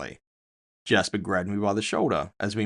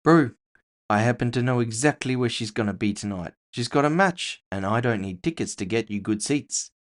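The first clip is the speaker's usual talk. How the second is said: higher, quicker voice for a streetwise orc friend; Jasper's voice; 4 semitones higher than usual. Acted. Jasper presses the narrator about the half-orc, casual and persuasive.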